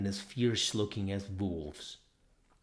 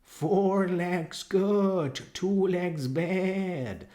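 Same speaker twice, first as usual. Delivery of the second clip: high, slow bleating chant; bleating sheep; 10 semitones higher, 5 dB louder, much slower than usual. Acted. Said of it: "tremendous bleating of".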